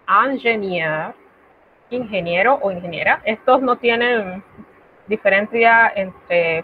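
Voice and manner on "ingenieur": ingenieur said slowly in French; careful model; slow French word. Shown as a demonstration. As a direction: stretch out ingenieur.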